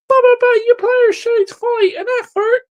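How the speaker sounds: high, whiny mock voice of a defending fan. Ranting fan mimics rival fans' excuses, whiny mock voice. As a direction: sneer it like a whining apologist.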